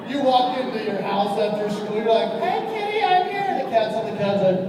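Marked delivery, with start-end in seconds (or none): 2.4-3.5 high, sing-song pet greeting; sweet call to kitty. Retold or imitated. Kid coming home greeting the cat, high sing-song. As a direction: coo at the cat.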